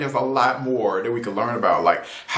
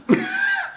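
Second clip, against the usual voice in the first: a sudden squeaky laugh of disbelief; high exasperated laugh. Real, not acted. Reviewer fed up with the show, high burst of laughter. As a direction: break into a high frustrated laugh.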